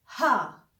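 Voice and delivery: हा with breathy h; German H. Shown as a demonstration. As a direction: say हा open and breathy. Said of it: "एच का sound आना चाहिए". (हा साफ़ बोलिए)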